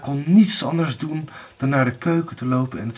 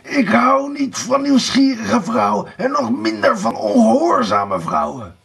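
wild rage; 9 semitones higher, 4 dB louder than usual. A narrator's reading. Blauwbaard rages at his disobedient wife, furiously. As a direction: storm at her furiously. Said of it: "hij zwaaide woest met zijn armen".

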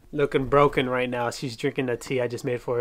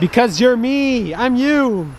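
loud slow insisting; 9 semitones higher, 8 dB louder, much slower than usual. Real, not acted. Insisting in role swap.

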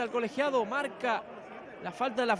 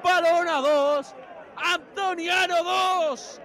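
slow, loud score call; announcing the score; 4 semitones higher, 4 dB louder, much slower than usual. Real, not acted. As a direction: spell out the scoreline.

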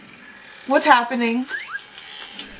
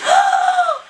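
very high gasping shriek; shocked gasp; 3 dB louder than usual. Real, not acted. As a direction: gasp in thrilled disbelief. Reaction to New Horizons reveal.

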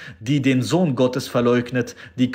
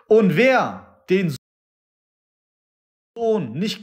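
raised revival-preacher cadence with falling ends; fervent preaching; 9 semitones higher than usual. Acted. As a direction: proclaim each line as a final truth. Preaching at full force.

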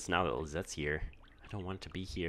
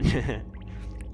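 short laugh; 5 semitones higher, 7 dB louder than usual. Real, not acted. Laughs at threatening note.

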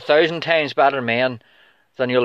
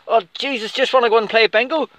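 a higher, whining mock voice; mocking whine; 9 semitones higher than usual. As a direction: whine like a fighter who wants to quit. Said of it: "you're like".

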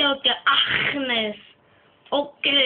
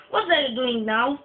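ordinary talk

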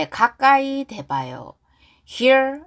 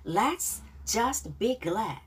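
slow model reading; 6 dB quieter, much slower than usual. Shown as a demonstration. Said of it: "자, 발음 따라하기".

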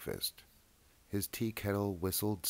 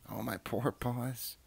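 mild whimper; 6 semitones higher, slower than usual. Acted. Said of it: "wringing his paws and crying".

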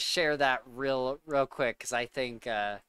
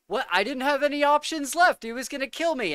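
much higher, pleading excuse; whiny Peter; 11 semitones higher, faster than usual. Retold or imitated. Mocking Peter's excuse to friends, higher whiny voice. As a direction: whine like a coward. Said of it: "Peter's like".

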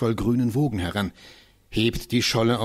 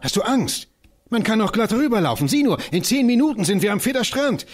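much higher, fast, bold dare; eager coaxing; 11 semitones higher, 4 dB louder than usual. Acted. Johannes dares Christiane to run across the ice, eager. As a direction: egg her on, full of thrill.

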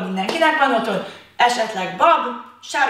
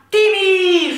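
loud, high call of the name; shouted name; 8 semitones higher, 5 dB louder than usual. Shown as a demonstration. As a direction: call it out like across a playground. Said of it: "kiabálja nagyon hangosan az anyukája nevét". (hangos, magas kiáltás)

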